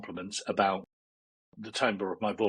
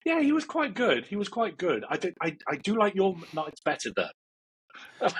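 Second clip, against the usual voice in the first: Jomo puppet voice; 8 semitones higher than usual. Acted. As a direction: tease the listener as the puppet, high and bright. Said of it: "He goes".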